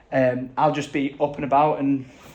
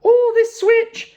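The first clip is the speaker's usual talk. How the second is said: imitating himself; 7 dB louder, much slower than usual. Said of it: "I'm like, there's a story".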